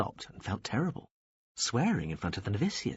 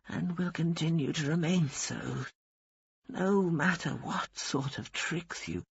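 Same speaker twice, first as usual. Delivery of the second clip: put-on pitched up, slow and dry old nun's voice; defiant old woman; 8 semitones higher, slower than usual. A narrator's reading. Dying old woman defying the angel of death, dry and stubborn.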